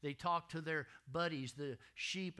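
ordinary delivery

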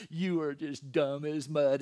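loud, gushing dog-praise voice, drawn out; doting dog-owner voice. Shown as a demonstration. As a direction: say an insult as if lavishing praise on a pet. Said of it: "go to your little dog and go".

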